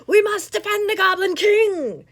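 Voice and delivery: high loud rallying cry; goblin chorus. Acted. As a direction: goblins rallying to their king. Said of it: "said the other goblins".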